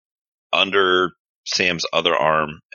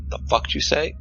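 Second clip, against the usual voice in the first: menacing whisper; 10 semitones higher than usual. Acted. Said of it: "just says".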